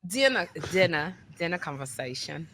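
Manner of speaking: put-on hushed, comic London accent; mock London accent. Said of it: "done a London role play".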